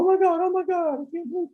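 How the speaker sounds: very high, frantic squeal of panic; mock panic in a shrill squeal; panicked shriek. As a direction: shriek it like a terrified man. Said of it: "he's out there going".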